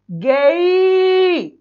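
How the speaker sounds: गेई held very long, sliding from e into i; very slow demo of गेई, ending on ee; audible i glide. Shown as a demonstration. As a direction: stretch गेई until the final i rings out. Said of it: "ई की sound भी आ रही है".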